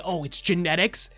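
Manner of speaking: ordinary delivery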